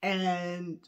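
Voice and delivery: ordinary talk, nothing marked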